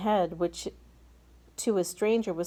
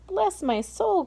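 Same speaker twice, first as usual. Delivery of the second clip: a much higher cry of astonishment; astonished cry; 12 semitones higher than usual. Acted. Fire chief seeing a boy on the peach, crying out amazed. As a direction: exclaim in disbelief. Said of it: "cried the head of the fire department".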